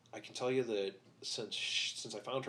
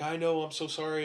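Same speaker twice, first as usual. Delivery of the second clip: imitated crying apology; 6 semitones higher, 7 dB louder than usual. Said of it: "she's crying".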